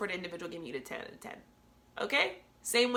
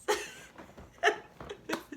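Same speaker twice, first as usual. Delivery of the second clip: high breathy burst of laughter; genuine laugh. Real, not acted. Laughing at her thuggy brother, a high genuine laugh. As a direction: let out a real laugh. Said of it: "This is a genuine laugh".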